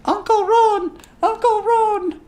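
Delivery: put-on very high excited child's call. Little Lily calls out to her uncle, high-pitched child voice. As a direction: squeal it like a little kid running up.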